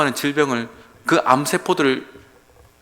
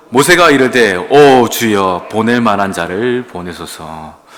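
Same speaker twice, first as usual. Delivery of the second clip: loud, very slow solemn plea; loud drawn-out reading; 3 semitones lower, 10 dB louder, slower than usual. Shown as a demonstration. 오 주여 drawn out loud in a solemn plea. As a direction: stretch out 오 주여 as a cry. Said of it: "읽어보겠습니다".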